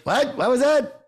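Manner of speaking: hammed-up deaf grandpa. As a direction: play a hard-of-hearing old man straining to hear.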